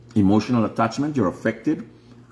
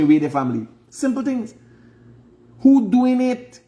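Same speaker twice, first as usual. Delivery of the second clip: impassioned plea; 9 semitones higher than usual. Real, not acted. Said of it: "preaching rise".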